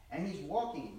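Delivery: ordinary talk, nothing marked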